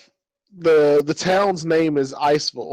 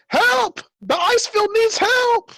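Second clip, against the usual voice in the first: very high falsetto cries while fleeing; panicked old man; slower than usual. Acted. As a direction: wail for help as you run off. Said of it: "running off into the distance, saying".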